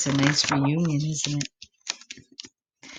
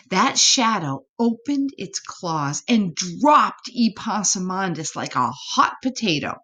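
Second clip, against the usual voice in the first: ordinary delivery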